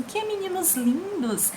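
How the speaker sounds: high, soft, drawn-out delight; joyful exclamation. Shown as a demonstration. Teacher shows a feeling of joy, joyful exclamation. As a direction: exclaim it sweetly, high and slow. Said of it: "expressando o sentimento de alegria".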